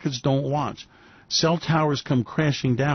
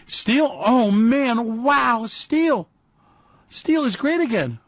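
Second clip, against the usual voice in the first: hammed-up wonder, much higher; gushing like it's a miracle; mock excitement; 7 semitones higher, much slower than usual. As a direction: fake awe over steel.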